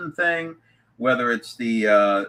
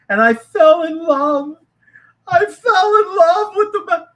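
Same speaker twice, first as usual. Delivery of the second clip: high, loud, tearful mock swooning; mock gushing; 11 semitones higher, 8 dB louder than usual. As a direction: wail it like a hammed-up lovestruck tale. Mocks gushing made-up stories.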